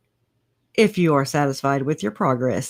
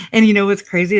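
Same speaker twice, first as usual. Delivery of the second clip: ordinary delivery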